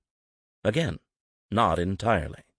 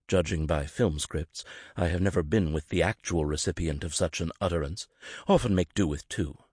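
normal talking voice

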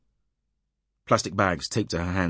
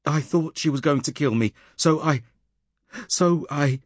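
higher, breaking with panic; distressed; 9 semitones higher, 3 dB louder than usual. Acted. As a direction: stammer out a frantic confession. Suspect breaks down answering police.

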